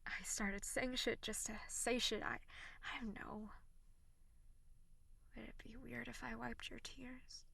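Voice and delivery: soft tender whisper; sultry narrator. Acted. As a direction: comfort them in a hushed voice.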